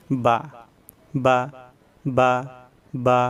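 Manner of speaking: "বা" said as a short, quick syllable with no lengthening; short quick vowel. Shown as a demonstration. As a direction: cut each "বা" short, one separate beat at a time. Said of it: "দ্রুত পড়তে হয়".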